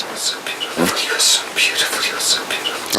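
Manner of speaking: a soft, unvoiced whisper of admiration; adoring whisper. Real, not acted. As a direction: breathe "You're so beautiful" in a hushed whisper.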